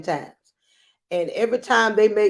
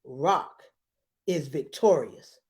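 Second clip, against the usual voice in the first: low, drawn-out announcer call; slow low ringside call; fight announcer; 5 semitones lower, 3 dB quieter, slower than usual. Acted. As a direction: announce it like a ring match. Announcing the winner.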